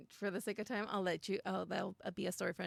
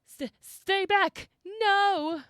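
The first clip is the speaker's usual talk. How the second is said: high, loud, slow terrified cry; strangled scream; 11 semitones higher, 10 dB louder, much slower than usual. A narrator's reading. Traveler screaming as the monster attacks, strangled terror. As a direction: scream it out in panic. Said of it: "is a strangled scream".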